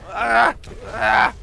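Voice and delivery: loud, high cries; panicked yells. Real, not acted. Player being hit, loud panicked yells. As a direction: yell out with each hit.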